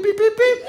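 high beeping noise; imitated beeping. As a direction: beep like a machine. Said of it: "dann macht er piep".

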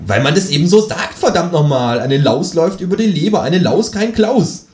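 loud, high-rising rant; exasperated outburst. Acted. Narrator corrects the kangaroo's proverb, loud exasperated outburst. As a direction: blow up over the misquoted proverb.

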